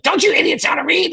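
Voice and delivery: high-pitched mocking rant at the hosts; mock freakout. Imitating Pete yelling at the hosts, high mock outburst. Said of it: "He's, like, freaking out".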